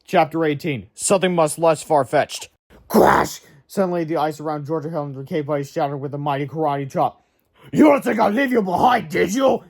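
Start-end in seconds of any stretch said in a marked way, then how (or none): 2.9-3.4 crash sound. A narrator's reading. Sound effect of ice shattering. 7.7-9.6 higher, loud cheerful rescuer; heroic giant voice. A narrator's reading. Giant rescuing the boys, calling out proudly. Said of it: "said Sawyer".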